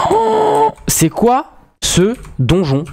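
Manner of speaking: high, slow awestruck gasp; amazed. Real, not acted. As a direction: marvel at it slowly. Amazed at discovering dungeon.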